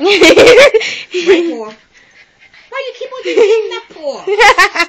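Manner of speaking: high, loud laughing plea; laugh-shout at the dog; laughing exasperation. Real, not acted. Dog keeps giving left paw.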